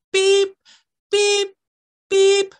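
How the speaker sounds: high, loud machine beep. A narrator's reading. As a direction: beep like a snow plough warning. Machine noise in story.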